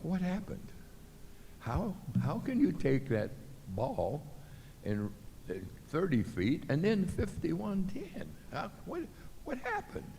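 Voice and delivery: retold puzzled self-talk. Driving home for a week asking himself, bewildered. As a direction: puzzle it out under your breath, rising. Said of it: "for a week I'm going".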